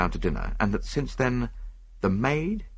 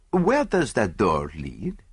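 Poirot; slower than usual. Acted. Poirot voiced.

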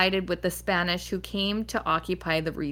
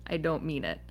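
usual speaking voice

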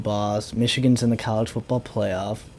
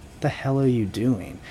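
ordinary talk, nothing marked

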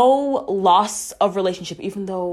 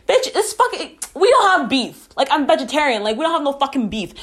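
exasperated retort; 8 semitones higher, faster than usual. Real, not acted.

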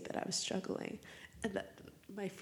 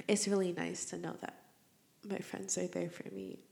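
ordinary talk

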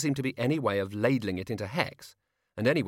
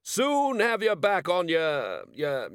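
high, loud, slow bellow; booming hearty shout; 7 semitones higher, 3 dB louder, slower than usual. A narrator's reading. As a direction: bellow it down the tube.

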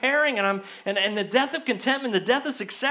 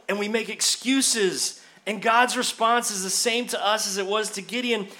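ordinary talk, nothing marked

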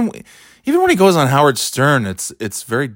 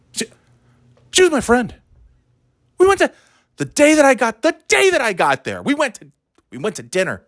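much higher, halting mock Alec; mock Baldwin; 12 semitones higher than usual. Comic mocking how Alec talks to the press, high. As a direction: stumble over it in a mock mournful voice.

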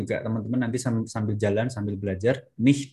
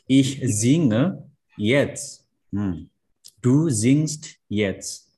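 Ich singe jetzt read very slowly and clearly; slow model reading; much slower than usual. Shown as a demonstration. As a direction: read each word slowly, as a model for the class. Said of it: "reading example sentences".

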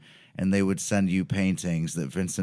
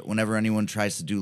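usual speaking voice

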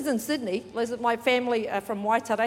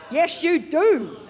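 loud, slow, drawn-out shout; rousing insistence; 5 semitones higher, 7 dB louder, slower than usual. Real, not acted. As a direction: holler encouragement at the crowd. Urging congregation to attend.

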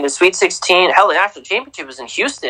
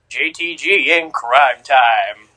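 slow, drawn-out hype intro; Crime Time impression; slower than usual. Co-host showing off his wrestling impression, slow hype voice. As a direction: draw it out like a tag-team catchphrase. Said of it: "I can do the Crime Time thing".

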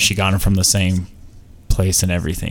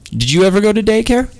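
high-pitched voice; 12 semitones higher, 4 dB louder than usual. Real, not acted. Sudden high playful question.

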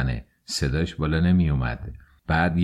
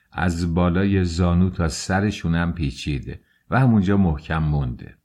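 ordinary delivery

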